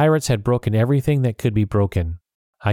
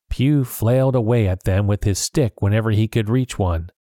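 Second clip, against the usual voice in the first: usual speaking voice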